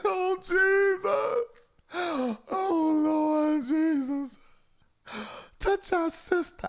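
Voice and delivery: high, slow, loud mock wailing prayer. Prayer line callers wailing to the Lord, mock wailing. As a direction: imitate a crying prayer warrior. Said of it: "They said".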